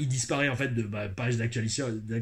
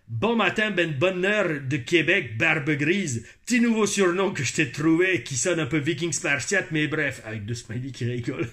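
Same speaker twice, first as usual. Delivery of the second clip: hammed-up Quebec French accent, raised energy. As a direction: read it out like an enthusiastic Québécois. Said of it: "je vais pas faire l'accent québécois". (accent québécois)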